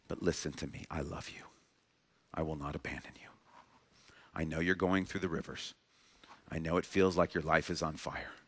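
God whispering love to a frightened heart; intimate tender voice. Acted. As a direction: speak softly to a scared child at night. God speaking softly to your heart.

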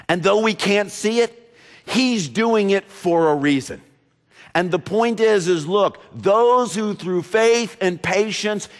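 high, loud, driving sermon delivery; preaching at full force. Acted. Pastor urging the congregation, at full force. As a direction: preach it out to the whole church.

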